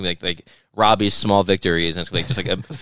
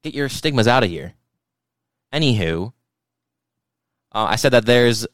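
ordinary delivery

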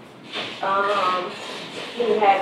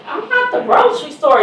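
high, loud, playful protest; mock indignant; 12 semitones higher, 5 dB louder than usual. Mother to son raiding her fridge, high playful indignation. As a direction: put him in his place, half joking. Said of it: "And I'm like".

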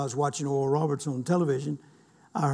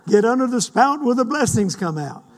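excited laughing repeat; 6 semitones higher, 6 dB louder than usual. Acted. Repeats quote louder, laughing.

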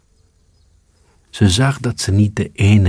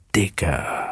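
soft, hushed Dikke, breathed to the cat; whispered; 7 dB quieter than usual. A narrator's reading. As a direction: keep it down, barely voiced. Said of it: "fluisterde de vos". (zacht gefluisterd)

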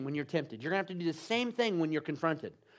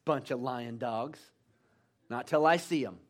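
scoffing disbelief; 7 semitones lower than usual. Acted. Thomas acted out scoffing at disciples.

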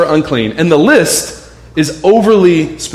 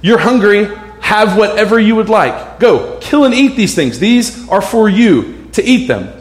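raised, commanding voice of God speaking to Peter; voice of the Lord; 6 semitones higher than usual. Acted. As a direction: proclaim it as the Lord, slightly higher and firmer. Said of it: "he hears this voice that says".

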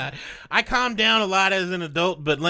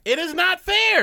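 whiny protest; 12 semitones higher than usual. Real, not acted. Protesting the game.

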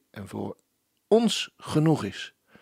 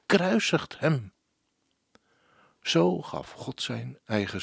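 solemn lyric recitation. Acted. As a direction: recite it like a devotional poem. Presenter recites the song lyrics. (plechtige voordracht van een liedtekst)

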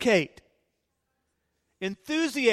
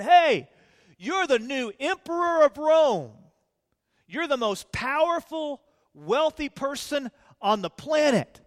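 mock flattering hype; 6 semitones higher, 4 dB louder than usual. Imagined flattering courtiers.

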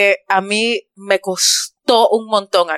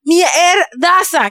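stretched, much higher, savoring the word; drawn-out exclamation; 7 semitones higher, much slower than usual. Real, not acted. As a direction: exclaim it slowly like the best thing ever. Raving about tequeños. (exclamación muy alargada y aguda, saboreando la palabra)

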